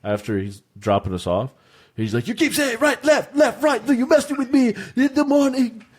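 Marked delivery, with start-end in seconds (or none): 2.3-5.8 high-pitched, worked-up mock Irish accent. Irish driver freaking out at passengers, mock-angry Irish accent. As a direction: imitate an irate Irishman, voice pitched way up. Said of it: "he talked in an Irish accent".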